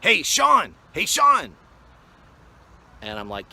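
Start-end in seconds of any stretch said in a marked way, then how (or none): 0.0-1.5 loud high shout across the lot; calling out. Retold or imitated. Rough guy calling to the news guy, loud shout. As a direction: yell his name from the tailgate. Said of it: "this one guy goes".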